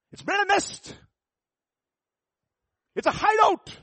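rising, loud preaching climb; preaching at full force. Acted. Preacher warning his congregation about the devil's nest, at full force. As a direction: build it up and hammer it home.

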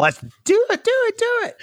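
very high screamed do it; imitating Kirk's scream. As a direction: shriek it in a high voice. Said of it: "where Kirk screams".

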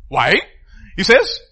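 ordinary talk

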